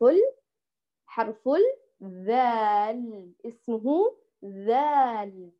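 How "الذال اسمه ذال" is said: ذال drawn out very slowly with tongue between the teeth; slow ذال with clear th sound; correct dhal. Shown as a demonstration. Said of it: "ليس زال ولا زه".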